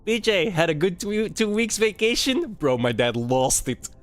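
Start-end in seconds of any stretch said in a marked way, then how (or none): 0.1-2.5 high, mocking director quip; lightly voiced sarcastic school director; smug director. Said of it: "he looks at me and he goes".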